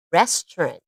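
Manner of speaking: Restaurant said as two syllables, res-trant, middle syllable dropped; native speaker pronunciation. Shown as a demonstration. Teacher modelling Restaurant for the class, middle syllable dropped. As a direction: say Restaurant as ress-trunt, swallow the middle. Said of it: "You almost don't hear this middle syllable".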